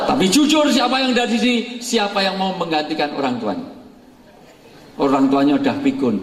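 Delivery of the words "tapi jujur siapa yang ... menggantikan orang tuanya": provoke them, voice high; rousing challenge. Real, not acted. Challenging the congregation.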